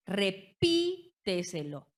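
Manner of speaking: "Repíteselo" lento, con PÍ alta y alargada; énfasis en la sílaba tónica. Shown as a demonstration. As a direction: marca el PÍ de "Repíteselo" y haz una pausa. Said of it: "donde la sílaba tónica va a recaer". ("Repíteselo" silabeado: re-PÍ-teselo)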